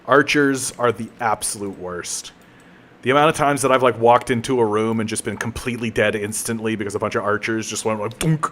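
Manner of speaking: ordinary delivery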